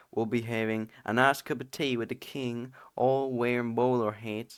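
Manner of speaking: put-on British accent. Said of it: "I can't do a British accent".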